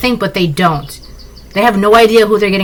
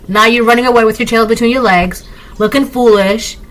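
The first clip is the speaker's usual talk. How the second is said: ordinary talk, nothing marked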